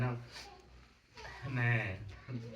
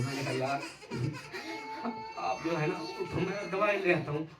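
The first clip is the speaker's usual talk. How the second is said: high, loud wailing sobs through the words; sobbing; 5 dB louder than usual. Real, not acted. Son telling how his father died, wailing in tears. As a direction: break down completely, crying out the words. Breaks down recalling father's death.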